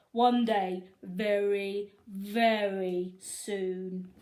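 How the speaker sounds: slow, drawn-out threat; mean old principal. A narrator's reading. Principal promising to catch the boys, slow and menacing. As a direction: stretch out the last words like a gloating villain. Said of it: "Mister Krupp vowed".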